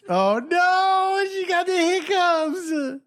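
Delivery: mock dismay. Teasing over hiccups.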